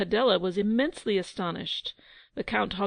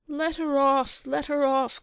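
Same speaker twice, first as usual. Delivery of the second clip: higher, louder crowd plea; crowd crying out; 5 semitones higher, 4 dB louder than usual. A narrator's reading. Crowd pleading for Rosalba, raised cry. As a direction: call out as the crowd. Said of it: "cried the crowd".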